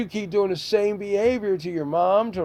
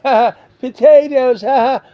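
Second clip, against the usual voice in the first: louder, higher mocking fake laugh; 7 semitones higher, 10 dB louder than usual. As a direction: ape the son's giggling, loud and high. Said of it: "you're over there laughing, going".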